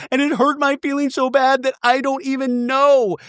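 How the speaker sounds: high, strained fake wail; mock tearless crying. Recapper mimicking housewife with hurt feelings, mock wailing. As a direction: imitate a hurt wail with no real tears. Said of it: "No tears still coming out of the eyes".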